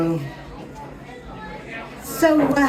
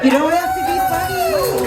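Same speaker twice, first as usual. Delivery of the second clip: much higher, teasing repeat; high mock echo. Shown as a demonstration. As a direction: squeak it back like a parody. Echoing the host mockingly.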